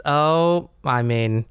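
usual speaking voice

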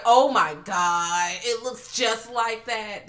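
slow, drawn-out amazement; slow awestruck gasp of recognition; slow amazed. Retold or imitated. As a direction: let the recognition dawn slowly. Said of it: "I was like".

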